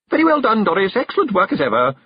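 high, loud gushing hostess; trilling. A narrator's reading. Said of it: "she trilled".

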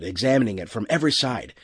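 usual speaking voice

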